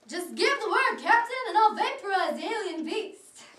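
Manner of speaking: loud, high sci-fi battle cry; hammed-up space hero. Space crewman offering the captain to fire, loud mock-heroic. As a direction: ham it up like a starship gunner. Plays science fiction crew member.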